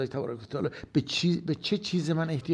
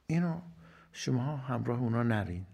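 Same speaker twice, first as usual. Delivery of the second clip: ordinary talk, nothing marked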